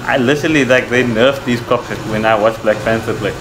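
usual speaking voice

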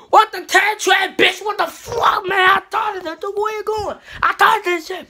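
a startled yell at a friend; surprised shout. Real, not acted. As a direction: yell it out like you can't believe it. Sudden outburst at friend.

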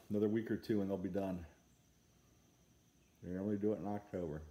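talking around pipe stem; puffing between words; pipe in mouth. Real, not acted. Seen 3: Hand brings pipe to lips by 0.8; smoke puffs follow.

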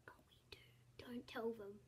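hushed whisper, secret to the camera; whispered aside. Retold or imitated. Kid confiding to viewers about the spam caller, whispered. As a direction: whisper it so the caller won't hear.

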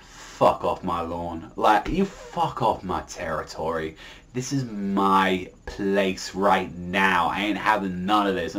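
low, slow, soft menacing growl; a lazy tough guy running people off his lawn; gruff Soma. Retold or imitated. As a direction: lay down the law in a low, slow voice. Soma chasing them off his lawn.

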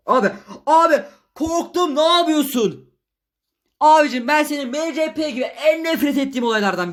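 high, loud yell of fright; startled yelling. Real, not acted. Startled gamer protesting to his mate after a scare, yelling. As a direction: cry out in real alarm, voice jumping up high. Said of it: "birazcık bağırdım".

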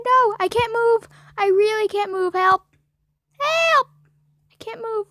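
high, loud panicky cries for help; panicked. Real, not acted. Stuck gamer panics and cries for help.

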